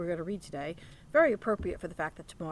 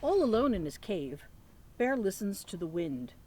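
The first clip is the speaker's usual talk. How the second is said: lively picture-book read-aloud; reading to children. Acted. Teacher reading Thanksgiving book to children, lively read-aloud. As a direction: read it like story time for little ones.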